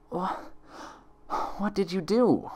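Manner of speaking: high, straining to force the words out; strained gasp. Acted. Weakened Twilight to her impostor, high and strained. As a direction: she can barely get the words out. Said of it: "Twilight gasped as she strained out the words".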